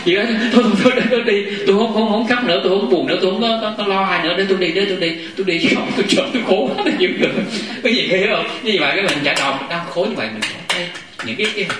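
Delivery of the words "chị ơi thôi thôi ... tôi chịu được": imitating a patient, high and louder; acted eager insistence. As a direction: insist in a rush of repeated words, pitched up. Acts out patient eagerly agreeing to go. (nhại giọng người bệnh cuống quýt, cao giọng)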